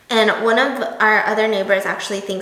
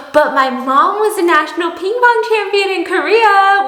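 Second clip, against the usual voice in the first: proud boast; 7 semitones higher, 5 dB louder than usual. Retold or imitated. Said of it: "I look at her and I go".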